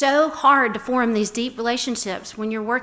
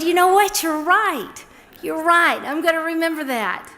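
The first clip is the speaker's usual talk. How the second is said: higher, louder, emphatic; retold excited agreement; 6 semitones higher, 4 dB louder than usual. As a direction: play her own delighted reply. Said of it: "And I said".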